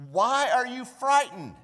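hammed-up puzzled Jesus. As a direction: ask it as if amazed. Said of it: "And he says this".

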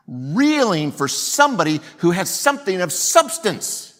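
loud, high preacher's cry; impassioned preaching. Acted. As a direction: preach it out with full force, hitting every key word. Preaches at full force.

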